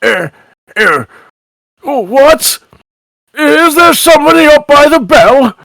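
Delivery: put-on high, groggy old-father character voice; voice from below. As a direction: call up, confused and suspicious. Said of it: "you do hear a voice from down below".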